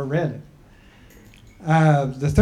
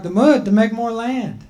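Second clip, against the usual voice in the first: a higher, slightly raised voice for the people; people's voice; 7 semitones higher, 4 dB louder than usual. Acted. The people telling Grandma Turtle she's too old, in a raised voice.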